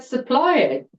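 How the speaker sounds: scoffing at the charge, laughing; laughing disbelief. Retold or imitated. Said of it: "And I started laughing".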